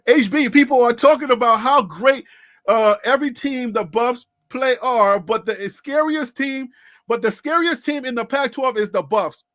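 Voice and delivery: loud, fired-up rant about the Buffs; loud hype shouting all night. Real, not acted. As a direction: yell it to the chat. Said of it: "Have I been screaming that all night?".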